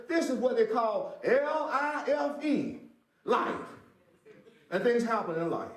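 high, loud preacher's cry; full-force preaching. Acted. Preacher lifting up the church, full-force preaching. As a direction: preach it out to the congregation.

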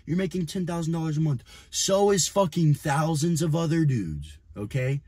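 ordinary delivery

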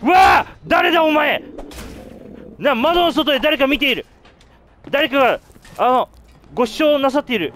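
high, loud panicked yelling; frightened shouting. Real, not acted. Scared by face at window.

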